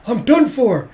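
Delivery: high, loud wail of doom; hammed-up dismay; panicked despair. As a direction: cry out as if all is lost. Boy despairs over secrets.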